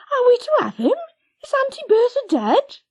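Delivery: high, loud anxious rush; anxious excited. Acted. Lizzie asks Patty if they keep the dog, high and anxious. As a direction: beg for an answer.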